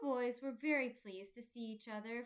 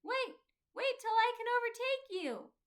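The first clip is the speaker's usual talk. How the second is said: high, loud, urgent call; crying out again; 11 semitones higher, 8 dB louder than usual. A narrator's reading. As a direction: call after him, straining to be heard far off. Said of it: "and he cried again".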